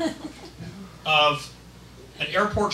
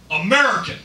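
loud, emphatic patriot; proud, forceful one-word answer; true US patriot; 2 semitones higher, 6 dB louder, faster than usual. Acted. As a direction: belt it out with national pride. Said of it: "U S patriot".

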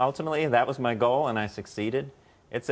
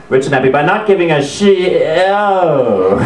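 mock-startled host, higher voice; imitated flustered stop; 5 semitones higher, slower than usual. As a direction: catch the swear word halfway. Host stumbles on a rude title.

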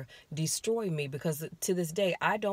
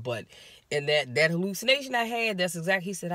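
ordinary talk

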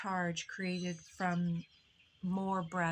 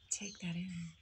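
whisper; 6 dB quieter than usual. Real, not acted. Whispered aside in guided reflection.

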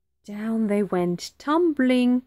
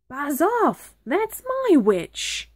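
high-pitched monster's warning; see off a rival as a shrieking beast; terrible beast; 8 semitones higher than usual. A narrator's reading. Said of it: "its terrible voice".

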